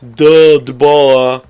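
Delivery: loud, slow, dumb duh voice; mock the obvious answer, dragging it out. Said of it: "You're probably gonna say".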